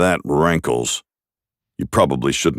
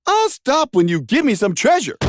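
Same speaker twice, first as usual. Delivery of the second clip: very high greedy demand; high hero voice. Acted.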